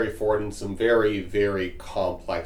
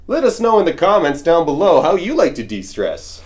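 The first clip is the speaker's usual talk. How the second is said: higher, louder radio host pitch; radio talk show voice; 9 semitones higher, 10 dB louder than usual. Pretend. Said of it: "there's my realtor voice".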